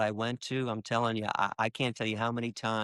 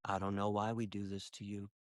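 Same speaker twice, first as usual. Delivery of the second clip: hushed, lower-pitched confession; tearful inner thought; 4 semitones lower, 10 dB quieter than usual. Retold or imitated. Man silently answering the pig, quiet and sorrowful. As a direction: retell a private thought very softly. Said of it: "tears rolling down my face, I say in my head".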